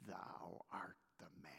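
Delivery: whispered, low, soft verdict; hushed accusation. Retold or imitated. Nathan pointing at David, whispered accusation. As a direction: let it land in a whisper.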